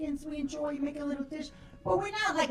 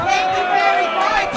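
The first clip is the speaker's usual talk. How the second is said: loud comeback; 9 dB louder than usual. Retold or imitated. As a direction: shout it as a sharp put-down. Snapping back at partygoers.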